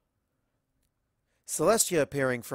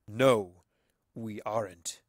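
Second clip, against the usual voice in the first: put-on an otherworldly voice from the child's mouth; eerie new voice; 5 dB louder, slower than usual. The entity speaking through Leafy, otherworldly. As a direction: sound like something else speaking through him. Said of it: "about three octaves lower than his real one".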